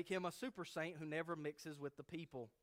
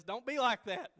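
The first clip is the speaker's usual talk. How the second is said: exclaiming; 9 semitones higher, 11 dB louder than usual. Acted. Preacher scolding mock attitude.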